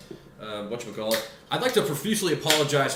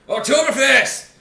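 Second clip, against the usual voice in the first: high, loud, slower hype shout; excited; 11 semitones higher, 11 dB louder than usual. Acted. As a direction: belt it out like a host. Show intro hype.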